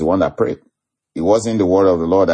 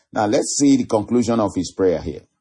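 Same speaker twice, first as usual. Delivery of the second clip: normal talking voice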